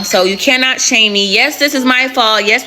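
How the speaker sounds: ordinary talk, nothing marked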